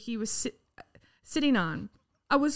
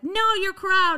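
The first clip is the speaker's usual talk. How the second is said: higher, louder sneering mimic; mocking the girl again; 8 semitones higher, 7 dB louder, slower than usual. Retelling the protester's jeer at the cops, high and loud. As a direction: throw her jeer back in a mock voice.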